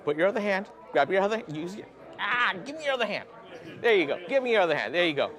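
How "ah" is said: ordinary talk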